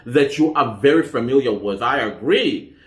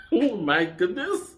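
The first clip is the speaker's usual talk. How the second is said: laughing to tears; 6 semitones higher, 7 dB quieter than usual. Real, not acted. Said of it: "Look at the tears".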